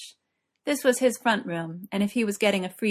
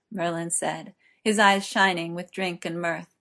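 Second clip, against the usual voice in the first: ordinary delivery, nothing marked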